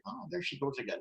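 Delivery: quiet dismissive aside; muttered aside. Retold or imitated. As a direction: mutter it under your breath, lightly mocking. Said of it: "some people would say".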